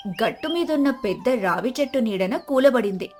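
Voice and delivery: plain reading voice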